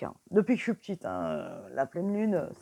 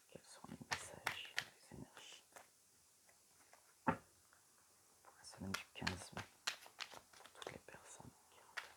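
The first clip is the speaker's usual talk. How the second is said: whispered question; 11 semitones lower, 22 dB quieter than usual. Real, not acted. Reader whispers her question to the oracle cards.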